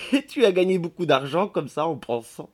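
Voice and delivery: barely recovered from laughing; through laughter. A narrator's reading. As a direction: tease him with laughter in the voice. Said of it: "put-elle enfin dire".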